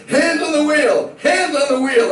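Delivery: high, loud, slow barked order; loud cop command, laughing through it; police command shout. Retold or imitated. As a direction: shout it like a cop with a gun drawn. Cop draws gun.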